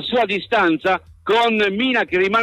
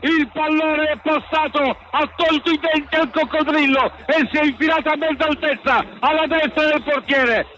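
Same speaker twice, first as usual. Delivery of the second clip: high, still buzzing after the goal; excited replay description, voice up; italian football commentary; 6 semitones higher than usual. Real, not acted. (telecronaca concitata dopo il gol)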